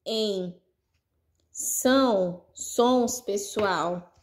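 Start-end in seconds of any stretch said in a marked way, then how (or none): 1.5-3.2 "são sons" drawn out very slowly with nasal vowels; slow nasal "são sons" for pupils; nasal sounds demo. Shown as a demonstration. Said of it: "sons que a gente chama de sons nasais".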